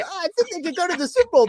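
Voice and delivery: high, fast gushing fan voice; mock giddy optimist. Fan mocks his own optimism in the bar, high fast gushing voice. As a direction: rattle it off like a starry-eyed believer. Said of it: "With my, when I".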